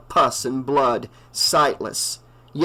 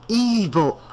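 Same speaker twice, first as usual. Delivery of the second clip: one raised, ominous word; grim doctor; 7 semitones higher than usual. A narrator's reading. Loomis answers the sheriff, one ominous word. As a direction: let the word hang like a verdict.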